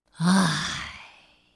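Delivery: long, low resigned sigh; weary sigh. Acted. Mr. Fussy closing his eyes in despair, weary sigh. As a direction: let it all out with a groan. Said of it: "他叹了一口气".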